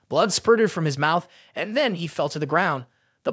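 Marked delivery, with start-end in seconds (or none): none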